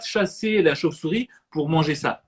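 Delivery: ordinary talk, nothing marked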